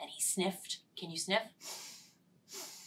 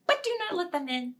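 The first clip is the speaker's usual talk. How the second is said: quick, higher playful warning; excited gasp; 8 semitones higher, 4 dB louder, much faster than usual. Shown as a demonstration. Teacher ending rhyme with a playful gasp. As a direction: snap it shut with a gasp. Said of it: "rhyme ends with a gasp".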